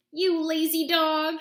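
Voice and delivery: high, loud, slow furious bellow; furious shout. Acted. Witch furious at the sleeping dog, shouting high and loud. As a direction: roar the insult at full force. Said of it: "shouted Bony Legs".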